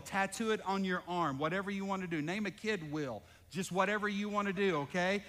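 usual speaking voice

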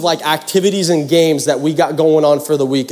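ordinary talk, nothing marked

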